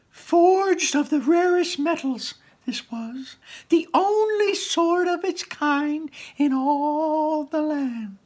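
very high, slow, awed storytelling; grand narration. A narrator's reading. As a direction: tell it slowly like a legend.

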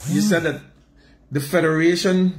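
ordinary talk, nothing marked

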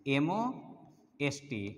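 very slow spelling "एमओएसटी", each letter drawn out; spelled slowly. Shown as a demonstration. Teacher dictating the spelling of most, very slowly. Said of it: "spelling out the word most".

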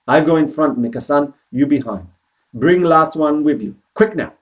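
lightly voiced old man taking charge, urgent; urgent Kensuke. A narrator's reading.

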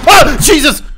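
loud high startled yelp; jolted by a scare; startled cry. Real, not acted. Jump scare. (erschrockener Aufschrei)

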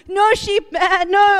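high, loud pleading at the lamb; yelling at a lamb heading for the cliff edge; pleading shout. Retold or imitated. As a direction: beg the lamb to turn back, loud and high. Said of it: "trying to talk lamb to it".

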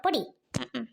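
normal talking voice

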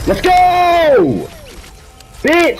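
high screaming celebration; victory yell. Real, not acted. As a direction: scream with triumph.

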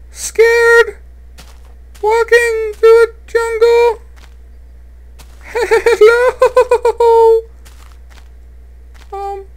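piercing falsetto damsel, wailing; hammed-up falsetto helpless noob; helpless girl voice. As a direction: play the lost damsel in distress. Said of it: "I gotta change my voice".

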